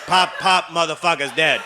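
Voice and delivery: ordinary talk, nothing marked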